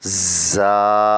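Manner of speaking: زا with a sharp whistling z; whistled za. Shown as a demonstration. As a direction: hiss the زا. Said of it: "تیز آواز سے پڑھا جائے گا".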